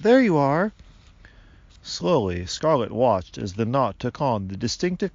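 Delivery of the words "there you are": high, loud greeting; excited yell. A narrator's reading. Father greeting the waking tree man, excited yell. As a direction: call out on spotting him. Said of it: "Lucas yelled".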